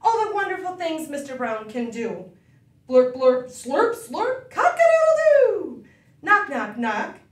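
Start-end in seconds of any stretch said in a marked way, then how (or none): 2.9-7.2 loud playful noises, with a high crow; sound effects medley. Pretend. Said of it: "sound recap".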